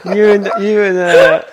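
real laughter breaking up the words; laughing. Real, not acted. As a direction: talk while cracking up. Cracking up at friend's accent.

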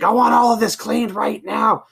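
high, loud put-on voice of a grumpy coworker; hammed-up surly coworker; surly boss demand. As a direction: bark the order in a mock cranky voice. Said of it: "And he would be like".

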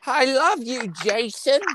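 high, crooning mother's voice; Missus Voorhees. Retold or imitated. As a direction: coo it high like a doting, creepy mother. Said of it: "That's what Missus Voorhees always used to say".

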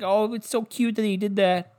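mock gushing fans. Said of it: "everyone's like".

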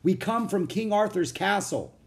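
put-on high, projected child's announcement; speaking loudly. A narrator's reading. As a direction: raise your voice over music. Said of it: "She spoke loudly to be heard".

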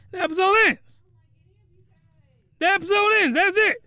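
high, punchy outburst with big pauses; worked-up, high-pitched exasperation; incredulous exclamation. Real, not acted. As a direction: announce it in scandalized disbelief. Exasperated recap of abrupt ending.

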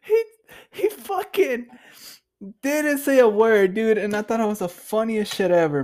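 high voice, still recovering from laughter; laughing hard. Real, not acted. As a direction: struggle to talk through real giggles.

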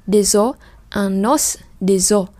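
des os said slowly, linking the z into os; slow liaison drill. Shown as a demonstration. Teacher slowly models liaison for students. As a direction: draw out the liaison. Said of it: "Một bên là N, một bên là Z".

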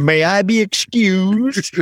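put-on high, slow prim little child; polite child voice. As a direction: ask like a well-mannered kid, slowly. Playing a child at adults' table.

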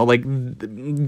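normal talking voice

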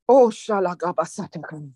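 speaking in tongues. Real, not acted. Preacher breaks into tongues.

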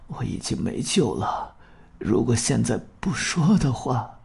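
soft, fading, dying man; weakly, words breaking off; faltering weak voice. Acted. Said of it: "断断续续地说". (断断续续、虚弱地说)

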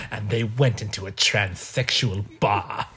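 slow, low mock-author narration; J K Rowling. Author writing her book, slow mock narration. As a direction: intone it slowly, spacing the words out. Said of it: "J K Rowling is writing her book right now being like".

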